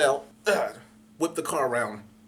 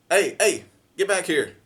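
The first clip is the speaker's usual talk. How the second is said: louder, higher call across the alley; shout after him; 5 semitones higher, 7 dB louder, slower than usual. Retold or imitated. Chasing the vandal down the alley, loud call. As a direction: yell at him to come back.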